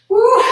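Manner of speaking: high, loud cheering whoop; excited whoop. Real, not acted. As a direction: whoop to fire up the class. Coach cheering on the class.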